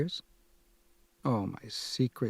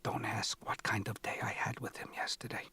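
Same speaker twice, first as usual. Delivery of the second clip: hushed conspiratorial whisper; breathy secret-sharing whisper to her son; mother's whisper; 9 dB quieter than usual. A narrator's reading. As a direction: whisper it so nobody else hears. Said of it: "The whispering promptly begins".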